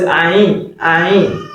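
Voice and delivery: "Ain" as many non-Arabs say it, without the throat sound; "Ain" with a plain vowel onset; common mispronunciation of Ain. Shown as a demonstration. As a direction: demonstrate the everyday non-Arabic "Ain". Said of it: "some people normally call it".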